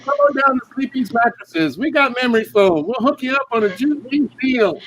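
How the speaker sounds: high, bright-sold TV commercial voice; mattress ad pitchman. Pretend. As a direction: pitch the mattress sale like a cheesy ad announcer.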